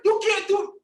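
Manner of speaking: high, loud mocking shout; mock scream. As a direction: keep screaming like the heckler. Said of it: "Calm down with all that screaming".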